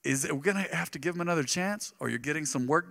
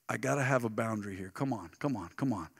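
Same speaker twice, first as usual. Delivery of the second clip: much softer, low, gently urging; soft coaxing; 5 semitones lower, 9 dB quieter than usual. Retold or imitated. Grandson gently coaxing his grandma in the car, soft and low. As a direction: coax her along quietly.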